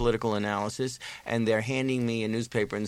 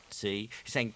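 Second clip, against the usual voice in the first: ordinary talk, nothing marked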